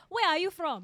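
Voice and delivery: imitating an African accent. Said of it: "we said that in an African accent".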